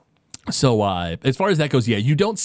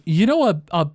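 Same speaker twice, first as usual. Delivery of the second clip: higher, eager apprentice voice; nervous apprentice; 9 semitones higher than usual. Acted. Zoff the apprentice agrees to the harness idea, higher and nervous. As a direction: sound a little nervous but game.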